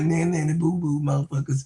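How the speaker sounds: mocking taunt. The teams jeering at fans, playful mock taunt. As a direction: taunt them like a jeering crowd. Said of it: "And they was like".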